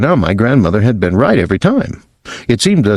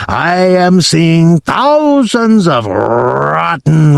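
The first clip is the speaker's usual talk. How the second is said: slow, higher witch voice with a German-style v, rising to a scream; disgusted tyrant working herself up slowly; Grand High Witch ranting; 3 semitones higher, slower than usual. Acted. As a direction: builds from slow sneer to high outrage. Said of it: "she screamed".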